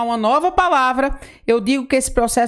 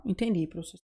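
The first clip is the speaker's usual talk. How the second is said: lower, much softer pupil reply; a small pupil answering the teacher, lower and softer; pupil's voice; 6 semitones lower, 12 dB quieter, faster than usual. Acted.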